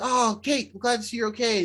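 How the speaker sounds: retold cry of relief; acted relief. Greeting Kate with relief in retelling.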